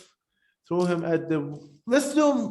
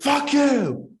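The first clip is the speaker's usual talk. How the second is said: high, sharp mock snarl of the insult; scary; 8 semitones higher than usual. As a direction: make the insult sound scary. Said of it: "was scary, man. He went".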